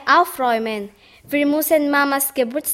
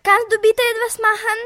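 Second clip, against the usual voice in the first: urgent fast plea; 8 semitones higher, faster than usual. Acted. Kind bittet hastig um Hilfe.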